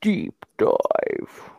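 low whispered trailer voice; dramatic whisper. Acted. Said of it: "Why was it cool when you did it".

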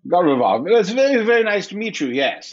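hearty handshake greeting; English hunter. Acted. Jack shakes hands.

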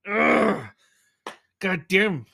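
usual speaking voice

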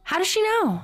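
high-pitched, incredulous reaction; shocked question. Real, not acted. Co-host reacting to the plot twist, shocked. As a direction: ask it in high disbelief.

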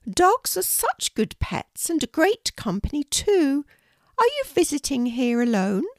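a kindly Queen welcoming a guest, pitched well up. Acted. The Queen inviting a shy girl to tea, high regal voice.

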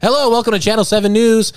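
high, loud mock newscaster; bright TV anchor read; news anchor. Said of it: "every news story should be like".

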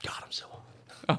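slow, breathy whisper of someone overheating; overheated whisper. Real, not acted. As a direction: whisper it slowly, worn out by the heat. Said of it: "Oh, no, I could tell".

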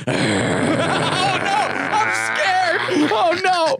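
high, squealed fake fright; mock fear. Playing along with the dog story, hammed-up fear. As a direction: play terrified in a silly high voice.

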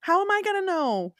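mock outrage; incredulous. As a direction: throw up your hands in disbelief. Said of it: "I was like".

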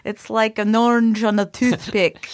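Scottish movie impression. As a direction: heckle in a broad Scottish accent. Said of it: "[name]'s now referencing, uh, So I Married an Ex-Murderer".

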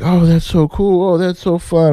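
high, gushing mock admiration. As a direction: parody the crowd's gushing praise. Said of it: "Everybody's like".

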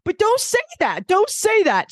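high-pitched mock warning; Dorinda. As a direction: plead indignantly in impersonation. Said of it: "Dorinda's like".

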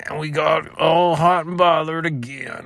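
high, slow crooning wail; hammed-up swooning. Podcaster mocking himself getting hot and bothered, hammed-up high swoon. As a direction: draw it out high, fading into a long "oh no". Comic acting out of overheated state.

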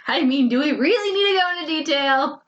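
amused, giggly protest; laughing. Real, not acted.

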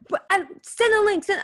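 high, pestering mock demand; frantic nagging. Friend pestering the other before the call, frantic nagging. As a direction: nag like a panicky sibling. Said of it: "siblings who fight all the time".

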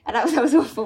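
normal talking voice

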